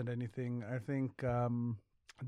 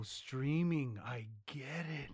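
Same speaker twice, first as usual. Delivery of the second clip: slow dawning realisation; 5 dB quieter, much slower than usual. Real, not acted.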